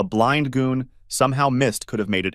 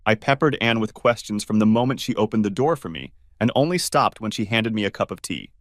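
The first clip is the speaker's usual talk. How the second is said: ordinary delivery, nothing marked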